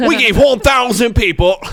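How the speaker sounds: high, loud mock-Australian YouTuber; broad, hammed-up Australian accent on the edge of laughing. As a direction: announce it big in a put-on Australian accent. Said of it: "that's my Australian accent".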